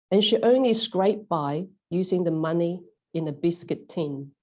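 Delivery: usual speaking voice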